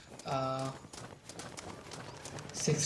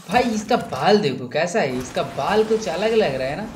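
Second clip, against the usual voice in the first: excited amused exclaim; 3 semitones higher, 7 dB louder than usual. Real, not acted. Gamer amused by character's hair.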